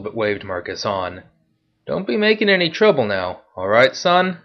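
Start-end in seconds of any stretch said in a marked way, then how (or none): 1.9-4.4 guard taunting the veteran, loud and high; mocking guard. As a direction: jeer at him as the guard. Guard warns Marcus.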